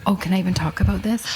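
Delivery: ordinary delivery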